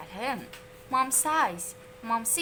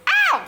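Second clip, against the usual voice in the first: sharp, much higher yelp; high yelp of pain; 11 dB louder than usual. A narrator's reading. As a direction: yelp as if hurt. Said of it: "yelped Andrew".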